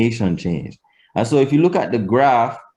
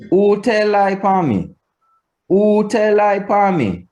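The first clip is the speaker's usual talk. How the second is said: WHO TELL LIE UPON ME held high, dropping on ME; Patois question intonation; 10 semitones higher than usual. Shown as a demonstration. Teacher showing Patois who questions, high-held question intonation. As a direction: hold the pitch up high from WHO, fall at ME. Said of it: "Completely different intonation between".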